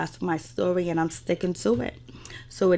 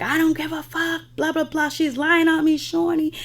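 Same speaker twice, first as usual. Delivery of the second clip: high-pitched, indignant imitation; mock defiant protest; 9 semitones higher than usual. Acting out Jackie complaining to Shawnee, mock defiant. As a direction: retell it as a high, whining-up protest.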